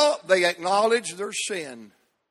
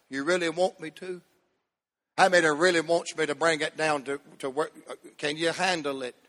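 soft, teasing question; teasing hush; 5 dB quieter, faster than usual. Real, not acted. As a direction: lean in and tease them quietly. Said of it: "teasing the crowd".

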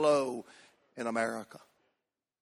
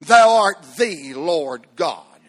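solemn, drawn-out scripture words; slow solemn quote; 5 dB louder, slower than usual. Retold or imitated. Preacher quoting the prayer's Hebrew sense, slow and solemn. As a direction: intone it slowly like scripture. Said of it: "That's what it means in Hebrew".

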